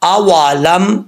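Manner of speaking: آوا لم slowly drawn out with rounded واو; joined reading. Shown as a demonstration. Teacher joining syllables for students, slow. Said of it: "لام میم زبر لم".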